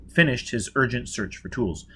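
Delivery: ordinary delivery, nothing marked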